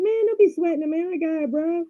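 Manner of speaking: high-pitched, acted nonchalance; breezy reassurance. Voices her own breezy reassurance.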